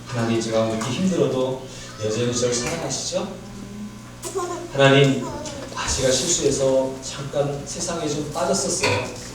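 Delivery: grateful prayer. Pretend. Pastor models the right prayer, softly and gratefully. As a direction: hush down and thank God tenderly. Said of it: "이런 마음이 기도하는 사람의 자세".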